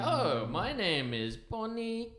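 high, hammed-up posh customer laughing through it; fake posh disguise voice. Friend playing a disguised local ordering craft beer, hammed-up posh. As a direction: put on a silly fancy voice to stay incognito. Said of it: "and you're like".

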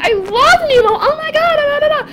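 high-pitched gushing babble; excited child. Retold or imitated. Young self gushing to the man about Nemo, excited. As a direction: gush like a delighted kid. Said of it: "I got so excited".

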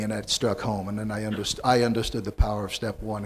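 usual speaking voice

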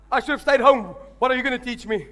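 loud, high scoffing; scornful farmer. Retold or imitated. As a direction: hurl it back at the preacher. Translating farmer's retort.

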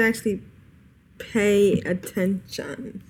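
slow and deliberate. Real, not acted. Player on harder boss fights, slow and deliberate. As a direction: drag out each word to make the point. Pointed punchline, slowed down.

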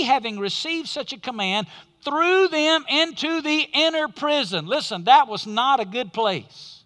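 normal talking voice

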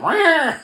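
high-pitched blender roar; imitated blender noise. Showing his hand turning into a blender, imitated noise. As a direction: voice a cartoonish whirring growl.

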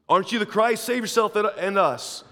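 high, loud jeering taunt; mocking criminal. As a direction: sneer at him like the mocking thief. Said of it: "hurled insults at Jesus".

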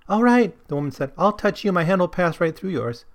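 high, light woman's voice; woman offering to touch him, high; woman in the story. Acted. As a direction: pitch well up for the woman. Woman voiced in read quote.

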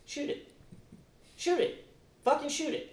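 high, hushed mock urging; James Harden. Player sitting there urging LeBron to shoot, high soft plea. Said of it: "James Harden's sitting there saying".

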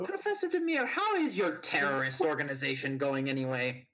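lightly voiced German villain; German accent. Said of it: "Are you mocking my accent".

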